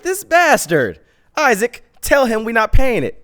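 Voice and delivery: high, loud indignant outburst; retold outrage. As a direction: yell it up high, fed up. Angry at driver overcharging.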